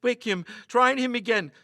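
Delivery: frightened stammer rising to a loud high cry; stammering fear. A narrator's reading. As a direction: stumble over the words, then call out in panic. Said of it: "stammered Lester".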